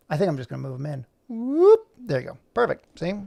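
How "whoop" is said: high, loud upward whoop; zippy rising sound effect; playful whoop. Pretend. Voicing moving the dog in.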